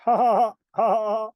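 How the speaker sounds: big hearty laugh; cracking up loudly; loud laughter. Real, not acted. Host bursts into loud laughter.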